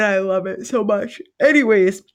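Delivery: yawning through the word. Real, not acted. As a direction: say it mid-yawn. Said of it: "I'm sorry for the yawn".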